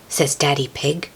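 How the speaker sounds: plain reading voice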